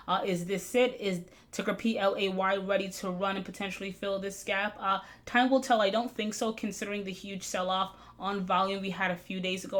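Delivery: ordinary talk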